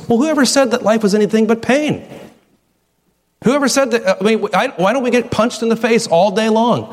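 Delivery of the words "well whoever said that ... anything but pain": ordinary talk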